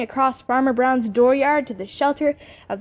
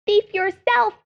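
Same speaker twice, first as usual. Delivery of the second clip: squirrel screaming back; 10 semitones higher, slower than usual. Acted. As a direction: shriek back at the jay. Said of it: "he screamed back".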